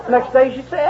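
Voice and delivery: normal talking voice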